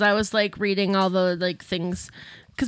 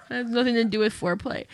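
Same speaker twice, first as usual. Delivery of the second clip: usual speaking voice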